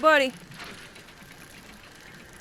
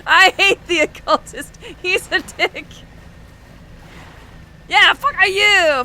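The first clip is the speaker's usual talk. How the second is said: high, loud mock fury broken by laughs; half-laughing furious rant at the teammate who picked her class; outraged yelling; 11 semitones higher, 8 dB louder, slower than usual. Real, not acted. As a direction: scream at your friend in playful outrage. Friend made her an occultist.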